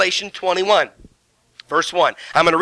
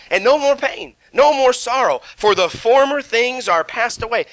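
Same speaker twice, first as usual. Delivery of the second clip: higher, proclaiming the promise; excited preaching; 5 semitones higher than usual. Acted. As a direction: preach it up high. Preacher climaxes.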